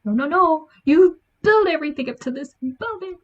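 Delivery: hammed-up outrage at the book; mock outrage. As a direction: protest like a betrayed reader. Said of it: "I was like".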